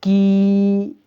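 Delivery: की with a long, held ee; teacher sounding out long की; pronunciation demo. Shown as a demonstration. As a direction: stretch the vowel in की. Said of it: "और इसका उच्चारण".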